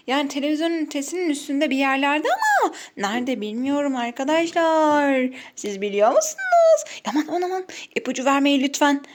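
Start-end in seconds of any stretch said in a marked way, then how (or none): 5.5-9.0 excited. Real, not acted. Teasing question to viewers.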